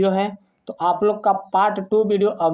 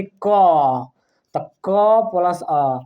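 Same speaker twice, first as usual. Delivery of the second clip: slow demo; 3 dB louder, much slower than usual. Shown as a demonstration. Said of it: "teacher demonstrates letter".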